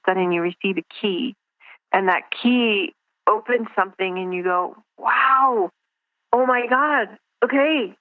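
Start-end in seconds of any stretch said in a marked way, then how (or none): 5.0-8.0 higher, drawn-out awed wow; retold amazement. Speaker voicing the moment the key opens something, amazed. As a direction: act out a sudden revelation, pitched up. Acts out a sudden realisation.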